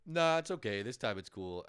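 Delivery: lightly voiced relaxed cop letting it slide; breezy metro cop. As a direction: wave him through like a casual cop. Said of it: "And they're like".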